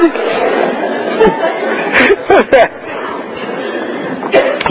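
breathy laugh at the memory. Real, not acted. Laughing while telling the congregation. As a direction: let a soft laugh slip out.

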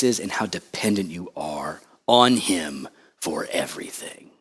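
low, soft, slowing pulpit delivery; solemn preaching. Real, not acted. Preacher on total dependence on God's grace, hushed solemn preaching.

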